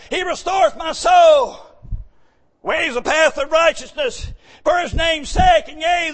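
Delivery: loud, high psalm recital, slowing on the last words; preaching the psalm. Acted. Preacher crying out Psalm twenty-three to the church. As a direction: cry out the psalm to the congregation.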